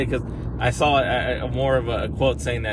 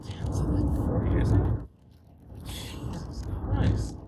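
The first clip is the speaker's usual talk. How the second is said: disbelieving whisper; 12 semitones higher, 6 dB quieter, slower than usual. Real, not acted. Realizing how time flies.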